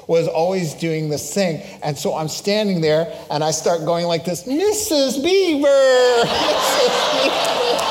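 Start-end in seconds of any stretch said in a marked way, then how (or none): 4.5-7.9 high, loud British call, drawn out slowly; laughing British shout through the name; Mister Beaver British accent. Retold or imitated. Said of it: "in his British accent".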